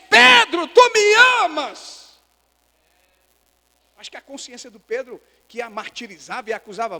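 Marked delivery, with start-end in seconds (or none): 0.1-2.2 loud, high shout, drawn out; impassioned questioning. Acted. Jesus asks Peter the third time, impassioned shout. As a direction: call it out slowly at full voice.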